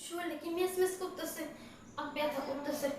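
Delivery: soft grumble about aches; weary complaint. Acted. Woman alone complaining of her aching body, soft weary grumble. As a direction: complain softly to yourself.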